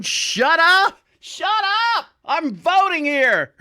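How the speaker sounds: loud, high exasperated holler; exasperated yell. Acted. Voter tells noisy old ladies off, exasperated yell. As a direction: yell it across the room, drawn out.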